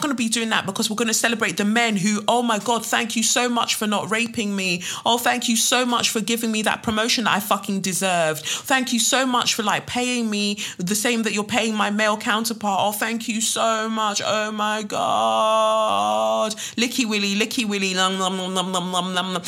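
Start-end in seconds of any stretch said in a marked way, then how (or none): 2.3-16.5 high-pitched sarcastic fawning; mock gushing gratitude. Mocking women gushing thanks to men. 16.8-19.4 singsong mock slurping-up; mocking sycophancy. Mocking fawning over men.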